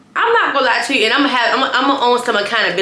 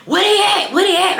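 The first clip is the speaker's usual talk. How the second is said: higher, pushy where-they-at nagging; irritated drunk demand; 10 semitones higher than usual. Retold or imitated. Drunk friend nagging Ryder about the Uber, irritated and pushy. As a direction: badger her like an irritated drunk.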